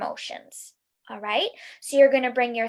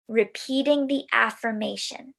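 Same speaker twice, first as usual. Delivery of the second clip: ordinary talk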